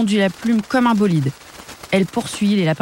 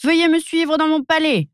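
much higher, grand royal invitation; queenly; 10 semitones higher than usual. A narrator's reading. Little girl as queen invites guests in, high and grand. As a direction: play the queen welcoming guests. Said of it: "la porte telle une reine".

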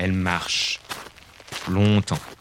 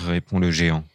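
usual speaking voice

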